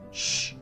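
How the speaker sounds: long hissed shush for silence; sharp shush. Acted. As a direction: hush someone mid-question. Hushing the speaker before the message.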